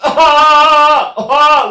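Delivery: loud high accented "ah"; baby cry accent. Shown as a demonstration. Singer analysing a baby's cry, loud high accented wail. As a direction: punch out a high, cough-started baby wail. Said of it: "Well, what is that?".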